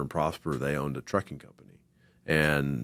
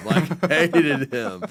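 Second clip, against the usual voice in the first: laughing; 11 semitones higher, 7 dB louder, much slower than usual. Real, not acted. Laughing emphasis, Prologis hated him.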